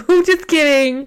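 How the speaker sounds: high, loud singsong; mock cheery cover-up. Teasing co-host about the wrong greeting, high loud mock backtrack.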